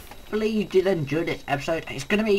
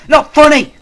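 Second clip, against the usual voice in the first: higher and louder, near screaming; furious yell; 9 semitones higher, 16 dB louder than usual. Real, not acted. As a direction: yell it at full force. Escalating complaint at the game.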